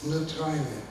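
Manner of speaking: measured verse with pauses after each line; poetry reading. Acted. Poet reading own German poem to an audience, measured recital. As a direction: recite it line by line, letting each image settle.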